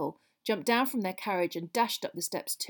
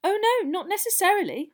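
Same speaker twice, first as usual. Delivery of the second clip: put-on high, louder girl's voice; schoolgirl correction; 11 semitones higher, 6 dB louder than usual. Girl correcting a friend's assumption, eagerly. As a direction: jump in to put someone right.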